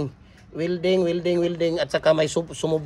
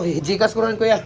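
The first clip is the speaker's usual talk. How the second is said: ordinary talk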